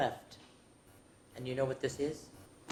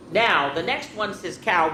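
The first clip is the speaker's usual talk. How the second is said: ordinary delivery, nothing marked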